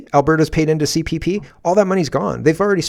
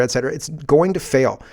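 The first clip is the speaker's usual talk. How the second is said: normal talking voice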